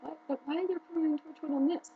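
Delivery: a light mock of confused surprise; surprised disbelief. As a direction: act baffled at the TV. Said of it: "And I was like".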